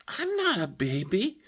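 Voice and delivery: a hint of a proud little boy; lightly voiced grandson. Grandpa imitating his three-year-old grandson, proudly indignant. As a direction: play the toddler insisting he's tough. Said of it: "He says".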